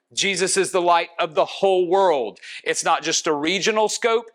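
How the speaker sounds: ordinary talk, nothing marked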